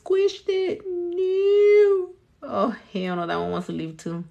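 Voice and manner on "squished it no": high, half-crying complaint; whiny wail. Real, not acted. After smudging her wet nail design, a crying whine. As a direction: wail over the ruined nail.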